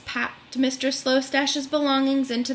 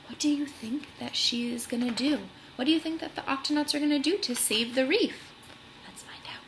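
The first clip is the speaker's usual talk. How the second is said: whisper; 5 dB quieter than usual. Real, not acted. Reader whispering to the children about what comes next, hushed whisper. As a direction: whisper it like a secret. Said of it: "whispered aside to children".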